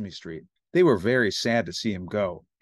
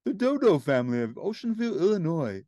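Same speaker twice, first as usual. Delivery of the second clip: a hint of a sweet-talking social worker; Miss Finch; 3 semitones higher than usual. A narrator's reading. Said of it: "said Miss Finch".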